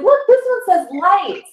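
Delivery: high, bright-eyed look at this; pointing out the sign eagerly; excited pointing. Retold or imitated. To her husband, pointing at a sign, high and excited. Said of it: "I'm like".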